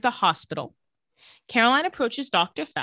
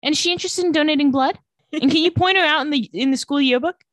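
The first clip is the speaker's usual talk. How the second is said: eager mock doctor; 6 semitones higher, 4 dB louder than usual. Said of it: "And Meredith's like".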